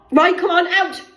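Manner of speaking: high, loud impatient yell; retold shout. Mum shouting at the carer to get out. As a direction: bark the order to hurry out.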